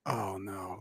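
very quiet, sinking "oh no"; said softly to oneself on realizing something is wrong; hushed dismay. Retold or imitated. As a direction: retell a private moment of dread, barely voiced. Retold realization of the injury.

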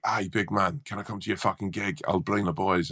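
lightly voiced Scottish flatmate, dropping soft; a hint of a Scottish accent, quietly pushy; half-hearted Scottish accent. Scottish ex-con flatmate begging to come to the gig, weak Scottish accent. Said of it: "I'm too hungover to a Scottish accent".

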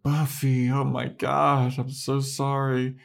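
high, drawn-out mock apology; mock apologetic Willow. Willow apologizing to Buffy, high mock-apologetic voice.

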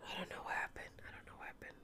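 very quiet whispered aside; real whisper. Real, not acted. Dog owner unsure what happened to her dog, whispering. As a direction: whisper it under your breath, puzzled.

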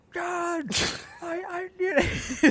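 high, shrill-pitched mock shriek, slowed down; mimicking screaming youth leader. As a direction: squeal it like the furious youth leader on the phone. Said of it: "screaming at me".

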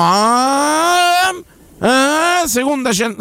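high, stunned disbelief; amazed. Real, not acted. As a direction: gasp it out in disbelief. Amazed at 190 in second gear.

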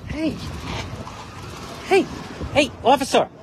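shouted high to get attention; calling out. Real, not acted. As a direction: yell across the street. Calling out to police officer.

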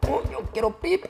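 high-pitched frustrated wail; hammed-up thwarted cry; Helen. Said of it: "Helen grita".

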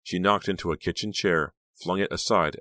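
ordinary delivery

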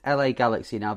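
normal talking voice

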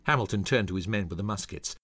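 plain reading voice

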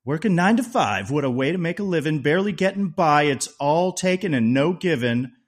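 high, loud, lightly hammed-up DJ reading of lyrics; radio DJ voice. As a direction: belt out the words like a radio DJ. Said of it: "Trying to do my radio D J voice".

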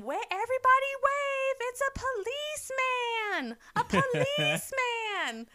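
very high, slow, drawn-out mock holler; imitating excited calling. Host mimics Michelle calling everybody to wave, hammed-up excited call. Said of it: "Michelle goes".

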